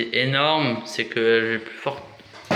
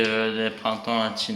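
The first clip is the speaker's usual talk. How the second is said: usual speaking voice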